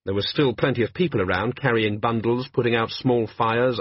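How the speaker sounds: normal talking voice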